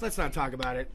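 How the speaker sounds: low, soft avoidance; guilty evasive. Retold or imitated. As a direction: mumble it to dodge the question. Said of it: "It'd be like".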